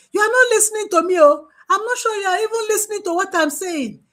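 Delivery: agitated complaint. Retold or imitated. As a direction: fire it off, upset that nobody listens.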